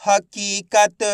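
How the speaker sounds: हकीकत slow, with a long kee; blended word demo. Shown as a demonstration. Teacher joining letters for pupils, हकीकत drawn out. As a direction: stretch हकीकत syllable by syllable. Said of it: "तो मिलके बन जाएगा".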